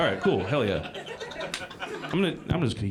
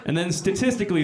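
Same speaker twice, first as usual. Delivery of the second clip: usual speaking voice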